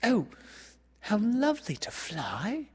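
high, airy wonder; dreamy delight. A narrator's reading. Wendy hearing she could fly, high delighted wonder. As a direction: breathe out high, girlish longing.

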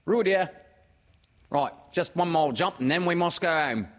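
high, loud mother character; fussing call, pitched well up; Kanga. Acted. Mother calling her child home, high and loud.

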